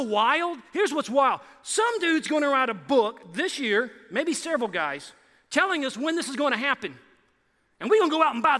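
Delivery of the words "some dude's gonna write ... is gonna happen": ordinary talk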